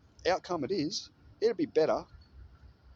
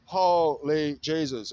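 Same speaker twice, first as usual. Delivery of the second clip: slow, louder amazed exclamation; 3 semitones higher, 7 dB louder, much slower than usual. Real, not acted. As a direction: drag it out in real awe. Amazed at the studio.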